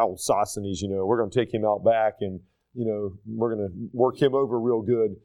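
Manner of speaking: low, menacing bully voice; mock tough guy. Corinthians plotting against Sosthenes, low mock-menacing. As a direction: growl the threat like a playground thug. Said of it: "the Corinthians were like".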